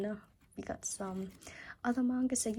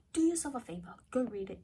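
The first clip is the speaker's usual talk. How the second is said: ordinary talk, nothing marked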